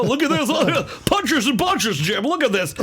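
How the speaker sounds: high-pitched, excited fake announcer voice; douchebag announcer impression. Announcer pointing out the fight to Jim, hammed-up excitement. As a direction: hype it up like a cheesy play-by-play man. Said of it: "this dummy is the one that goes".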